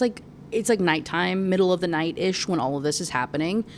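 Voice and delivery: ordinary delivery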